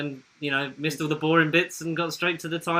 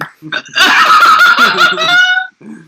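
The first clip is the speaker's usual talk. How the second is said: loud laugh; 15 dB louder than usual. Real, not acted. Big laugh at joke.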